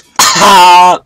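high, very loud open "ha"; loud laugh. Real, not acted. Player enjoying throwing someone into water, loud laugh. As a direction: burst out in one big high laugh.

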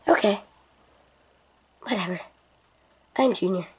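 mock him in a dull low voice. Friend flatly mocks Junior's intro in a low voice. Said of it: "I don't have that wee wee voice".

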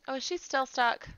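loud, exasperated call out. Real, not acted. Calling to stuck sim.

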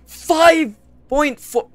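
high, slow, incredulous shout; stretched-out shocked yell. Real, not acted. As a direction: cry out in disbelief at the number. Shocked at character weight.

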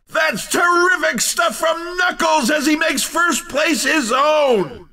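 high-pitched, punchy victory commentary; excited winner call. Acted. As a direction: hype up the race winner. Announcer celebrates a first place.

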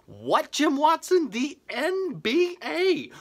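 high, slow mock disbelief; incredulous. Comic to Jim Watson, mock outrage at his comparison. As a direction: act floored by the absurd claim. Mocking disbelief at a friend's claim.

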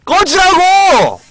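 loud, high, slow shout; angry shout. Real, not acted. Fed up with the teasing friend, furious slow shout. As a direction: stretch the words out in a furious yell.

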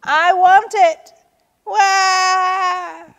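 put-on high, loud childish demand; tantrum wail. A narrator's reading. Turtle demands what she wants, wailing loudly.

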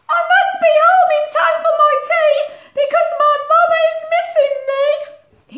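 put-on very high, loud pleading child; little Roo voice. Little Roo pleading to get home to mum, very high. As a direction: whine like a homesick joey.

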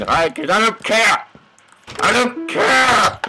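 high, drawn-out second refusal; defiant outburst. Pretend. Player who didn't replant the wheat, defiant outburst. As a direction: belt out your indifference, building.

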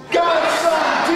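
high, loud call. Acted. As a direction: call it out to the sky. Call response.

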